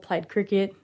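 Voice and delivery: ordinary talk, nothing marked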